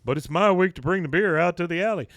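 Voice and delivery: loud, high, quick complaint; indignant protest. Acted. Hank objects to bringing juice to alley, loud protest. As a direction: protest in mock outrage.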